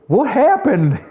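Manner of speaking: high, loud mock bewilderment; bewildered. Mimicking a teammate confused by an explosion, high and loud. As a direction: play him baffled by the blast. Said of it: "he goes".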